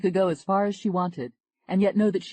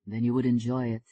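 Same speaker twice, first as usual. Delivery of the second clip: a smooth Frenchman greeting her; French accent; 4 semitones lower than usual. Acted. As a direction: lower French accent, gently charming. Jean-Claude continues.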